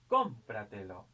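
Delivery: "Cómpratelo" with a loud "Cóm", then falling away; stress on first syllable. Shown as a demonstration. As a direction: hit the "Cóm" of "Cómpratelo" hard. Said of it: "este es sobresdrújula".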